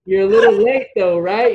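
loud, high ribbing retort; retold taunt. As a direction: throw it back at a rival. Retold taunt back at rival.

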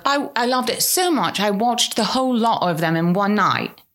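imitated Essex-Cockney English accent; friend's English accent. English friend writing back about the show, imitated English accent. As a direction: mimic her English accent. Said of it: "that's how she'd say it in her accent".

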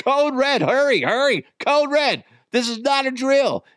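high, urgent repeated alert; hammed-up high-pitched distress call; mock emergency alarm. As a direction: hurry your wife downstairs in comic panic. Urgent retold call to wife.

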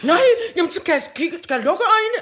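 high, loud, fast panicky apology; teacher voicing the fearful boy; scared boy. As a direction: plead like a frightened kid. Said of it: "Og angst blev".

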